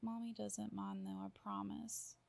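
normal talking voice

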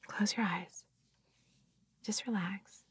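soft calming whisper. Acted. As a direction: breathe the words out gently.

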